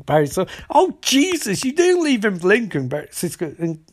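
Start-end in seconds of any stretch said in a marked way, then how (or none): 0.7-2.9 high-pitched appalled reaction; mock dismay. Barry learning Cisco was left with Lincoln, appalled. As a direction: jump up high in alarmed disbelief. Barry's retold alarmed realisation.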